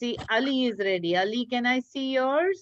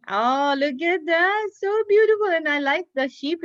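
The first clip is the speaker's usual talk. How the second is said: rising to a high, loud delighted cry; excited praise; 7 semitones higher, 6 dB louder than usual. Real, not acted. Teacher praising Aiza's smiley sheep, excited high gush. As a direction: gush over it as if it's the best one yet.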